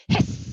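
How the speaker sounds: loud, sharp hiss; snake hiss. Retold or imitated. Stick turning into a snake, loud hiss.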